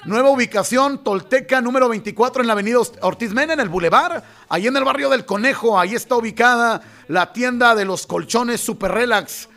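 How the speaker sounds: upbeat announcer pitch for a store; lively commercial read; radio ad read. Acted. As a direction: sell the opening day like a radio spot. Reads mattress store ad.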